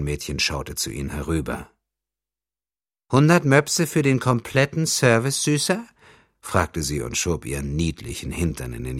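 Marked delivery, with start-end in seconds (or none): 3.1-5.9 put-on high, louder girlish voice. A narrator's reading. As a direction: coo it at him like a teasing young girl. Young prostitute voiced high. (kokette hohe Mädchenstimme)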